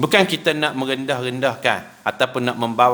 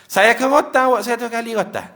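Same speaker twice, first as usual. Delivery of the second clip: higher, fast, hammed-up angry oath; 8 semitones higher, faster than usual. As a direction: spit out the vow in a temper. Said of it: "Hilang sabar dia".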